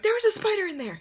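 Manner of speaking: high, loud blurt; panicked outburst. Retold or imitated. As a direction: yell it at mom over the box. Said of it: "I'm like".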